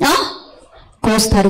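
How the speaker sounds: ordinary talk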